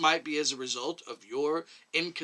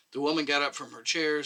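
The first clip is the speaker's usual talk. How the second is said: ordinary delivery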